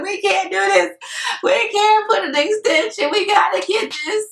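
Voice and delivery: high, anxious pleading; begging the realtor in a high worried voice; retold panic. To her realtor, panicking about the closing, high pleading voice.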